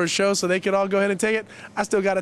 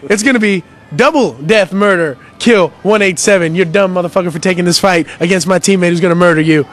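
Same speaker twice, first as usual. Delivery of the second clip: trash talk; 11 dB louder than usual. Pretend. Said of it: "I'd be like".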